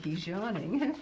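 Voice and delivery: usual speaking voice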